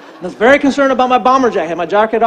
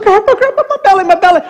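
put-on high, louder little-girl voice; urgent high kid imitation; distressed granddaughter; 7 semitones higher, 4 dB louder, faster than usual. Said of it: "and she's like".

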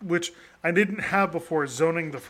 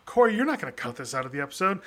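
normal talking voice

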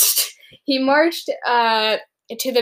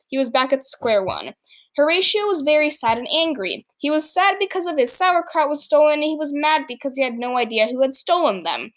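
usual speaking voice

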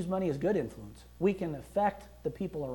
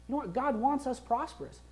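ordinary talk, nothing marked

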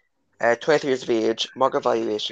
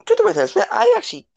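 sudden much higher, louder voice; high excited outburst; 5 dB louder, faster than usual. Real, not acted. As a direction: burst out high and loud.

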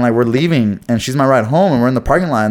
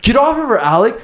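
retold yell; 9 semitones higher than usual. Acts out Nathan shouting to intervene.

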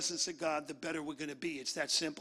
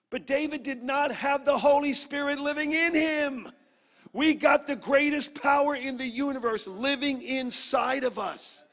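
raised, high-pitched, forceful proclamation; fervent preaching; 6 semitones higher, 6 dB louder than usual. Real, not acted. Preacher proclaiming the power living in believers, loud and fervent. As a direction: drive it home to the whole church. Preacher drives home the main point.

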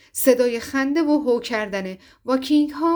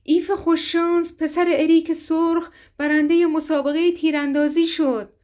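higher, louder excited proclamation; thrilled announcement; 6 semitones higher, 3 dB louder than usual. A narrator's reading. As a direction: crow the winner's name with glee. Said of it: "جوانک ذوق زده گفت".

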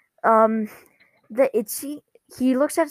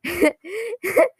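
high, rising giggle; real high-pitched laughter; 9 semitones higher than usual. Real, not acted. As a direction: giggle at your own retelling. Laughs after acted quote.

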